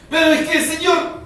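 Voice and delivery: high, loud desperate protest; begging the Lord to hurry up; pleading. Acted. Believer protests impatiently to God, loud pleading.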